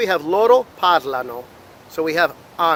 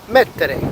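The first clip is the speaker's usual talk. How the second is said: "mettere" with the emphasis on MET; stress on first syllable; 3 dB louder than usual. Shown as a demonstration. Teacher listing ERE verbs, stressing MET in "mettere". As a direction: hit MET at the start of "mettere". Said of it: "falling on the beginning".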